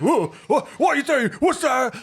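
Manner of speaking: very high, loud panicked squeal; frightened thug. Acted. Thug scared by the watch, high panicked squeal.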